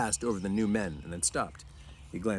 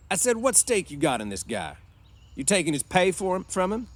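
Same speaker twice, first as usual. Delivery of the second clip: loud, higher-pitched, pressing questions; suspicious boss; 11 semitones higher, 7 dB louder than usual. A narrator's reading. Boss questioning George's stake in his friend, suspicious. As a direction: press him like a distrustful ranch boss.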